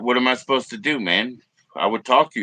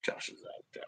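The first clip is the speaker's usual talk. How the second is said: whispering; 20 dB quieter than usual. Real, not acted. Hushed aside during livestream.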